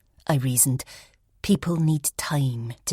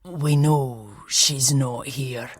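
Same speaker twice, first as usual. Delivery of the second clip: ominous; much slower than usual. Acted. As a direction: let it land with dark weight. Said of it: "the older man said ominously".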